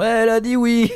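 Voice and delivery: excited cheer. Retold or imitated. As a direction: cheer it like the crowd. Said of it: "les gens font".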